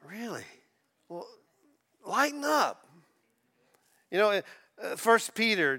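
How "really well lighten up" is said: teasing plea. Acted. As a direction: coax them out of their gloom. Mocking joyless Christians.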